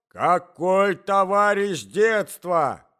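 slow, loud, incredulous yell; old man shouting. A narrator's reading. Stamping old husband rejecting the excuse, shouting. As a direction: throw the phrase back, drawn out and loud. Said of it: "кричал старик".

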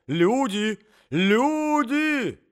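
very high, loud cry; calling for help. A narrator's reading. Alarmed old man summoning servants, calling for help.

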